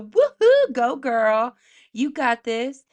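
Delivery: high, loud whoop of encouragement; cheering. Pretend. Storyteller cheering confident Cinderella on, high loud whoop. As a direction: whoop it like her biggest fan.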